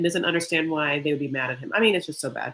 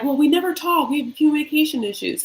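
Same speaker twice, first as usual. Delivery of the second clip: mock complaint; 8 semitones higher, 4 dB louder, faster than usual. Retold quarrel, quoting Anna.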